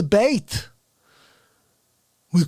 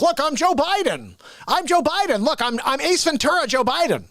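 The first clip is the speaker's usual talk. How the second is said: mocking, raised-pitch impression with bouncy intonation; Jim Carrey as Biden; 4 semitones higher than usual. Mocking SNL's casting, higher sing-song parody voice. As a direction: exaggerate a comic actor badly playing a politician. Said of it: "And he talks like that".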